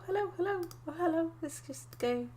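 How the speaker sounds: ordinary delivery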